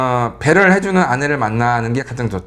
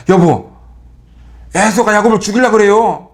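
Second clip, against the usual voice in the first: blunt alarm; 5 semitones higher, 5 dB louder than usual. Retold or imitated. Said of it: "뭐 이렇게 했으면은".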